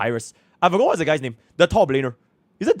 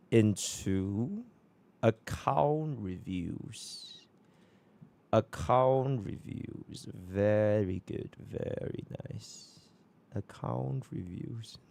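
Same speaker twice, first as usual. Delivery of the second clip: slow, softer drawn-out words; 9 dB quieter, much slower than usual. Real, not acted. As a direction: stretch the words out slowly. Dragged-out quiet muttering.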